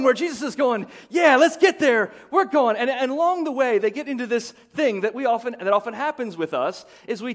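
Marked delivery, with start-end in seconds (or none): 1.1-2.8 eager disciple, raised and pushing forward. Pretend. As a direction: cheer the group on toward the goal. Preacher voices eager disciples.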